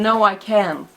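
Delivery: usual speaking voice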